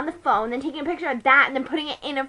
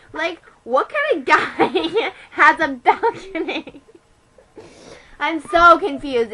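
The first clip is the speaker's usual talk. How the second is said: laughing; 2 semitones higher, 5 dB louder, slower than usual. Real, not acted. Laughs over the balcony detail.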